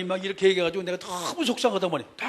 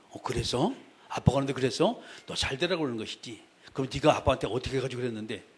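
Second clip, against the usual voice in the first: soft, lower, probing; gently questioning mother; 7 semitones lower, 7 dB quieter than usual. Retold or imitated. Mother calmly questioning the child, softly. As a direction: coax the story out of the child. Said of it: "꼬치꼬치 물면서".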